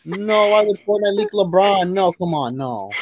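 high, drawn-out pleading disbelief; dismayed protest. Real, not acted. Fan lamenting Fortnite's LeBron leak, dismayed protest. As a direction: protest it in a high, slow wail.

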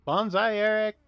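high-pitched mock war cry; playful battle cry. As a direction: sing it out high, teasing the enemy. Playful calling out to in-game enemy.

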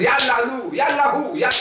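Ya Allahu called out high, Ya leading; high-pitched cry of Ya Allahu; exclaimed demonstration. Shown as a demonstration. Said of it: "it's like this".

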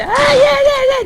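imitating a politician yelling from the stage; Tayyip shouting. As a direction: bellow it like a hoarse politician at a rally. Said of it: "bir tarafta Tayyip bağırıyor".

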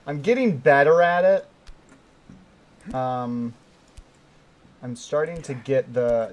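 ordinary delivery

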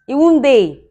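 loud, high punchline; emphatic exclaim. Real, not acted. Wife crying out to her fans about her husband, loud and high. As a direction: throw the line out big and high.

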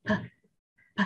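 P with lips pressed, then released in a puff; isolated, voiceless burst of P; popped P. Shown as a demonstration. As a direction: press the lips and pop out each P. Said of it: "Chúng mình mím môi và bật ra".

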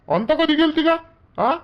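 high-pitched, startled demand for answers; agitated questioning. Acted. Husband learns of wife's outing, agitated questioning.

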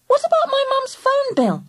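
high protesting question; objecting. A narrator's reading. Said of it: "I objected".

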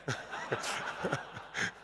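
quiet airy chuckle; soft breathy laugh. Real, not acted. Laugh after joke.

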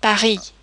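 "Paris" in French, pa-ree, no S; silent final consonant. Shown as a demonstration. Teacher gives French example, "Paris" with silent S. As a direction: end "Paris" on the ee vowel. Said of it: "they are normally not pronounced".

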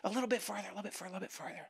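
soft, rapid, fading to near nothing; creeping fade. Acted. Person sliding into compromise, quick fading murmur.

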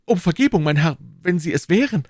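high, loud flustered apology; astonished, apologetic cry. A narrator's reading. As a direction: exclaim it, then beg pardon. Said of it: "rief D'Artagnan auf einmal durch einen Gedanken erleuchtet".

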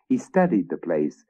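ordinary delivery

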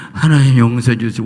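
crying-out prayer. Shown as a demonstration. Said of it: "울어, 막 울어".

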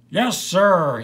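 put-on loud, high drunken insistence; earnest cry. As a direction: cry it out as if someone argued. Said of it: "he cried with deep earnestness".

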